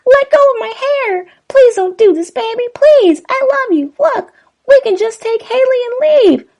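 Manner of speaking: high pleading falsetto woman; begging Kim voice. Acted. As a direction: beg high and loud as Kim. Kim begging for her life.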